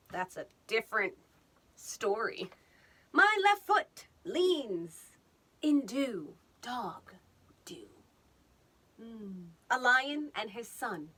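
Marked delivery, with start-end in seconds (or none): 3.1-7.9 slow, grand storyteller delivery; dramatic recital. Pretend. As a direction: read it like a solemn poem, slowly.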